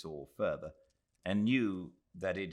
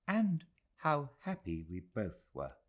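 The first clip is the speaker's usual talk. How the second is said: put-on higher old lady voice, wistful; Mrs Moore; 9 semitones higher than usual. A narrator's reading. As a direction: say it as a fond sigh of memory.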